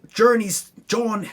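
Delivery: normal talking voice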